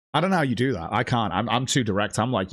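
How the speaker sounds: usual speaking voice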